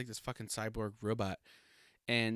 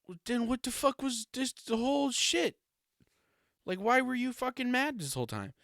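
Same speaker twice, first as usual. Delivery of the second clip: much higher, incredulous mock rant; exasperated outburst; 13 semitones higher than usual. Mark yelling at Amber over the reveal, exasperated high rant. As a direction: fling it out in disbelief, voice shooting up. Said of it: "he's like".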